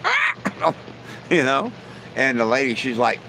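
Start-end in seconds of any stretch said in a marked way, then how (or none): 0.0-0.7 short, high-pitched bird squawk; parrot squawk. Acted. Joke teller voicing the lady's bird, high squawk. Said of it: "And that bird like".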